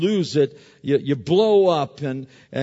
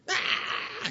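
sharp high breathless cry of frustration; a quick unvoiced yelp, fed up; high gasped ah; exasperated cry; 7 dB quieter than usual. Real, not acted. Frustrated at mom's reasoning.

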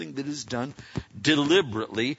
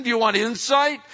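ordinary talk

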